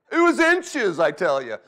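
high, loud, hammed-up outburst; exaggerating storyteller. Dad retelling near miss to his son, loud mock alarm. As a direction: blurt it out as a comic tall tale. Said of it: "I was like".

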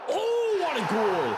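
high, loud ecstatic yell; goal celebration. Real, not acted. Streamer erupting as his striker scores, ecstatic. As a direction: scream it like a goal just went in.

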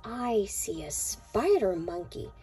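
ordinary delivery

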